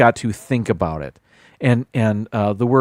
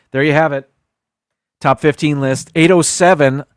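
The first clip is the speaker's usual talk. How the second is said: ordinary talk, nothing marked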